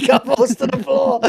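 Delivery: high-pitched real laughter through the words; laughing. Real, not acted. Narrator cracks up telling of the preacher's fall, real laughing. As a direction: finish the sentence cracking up. Cracks up after his own impression.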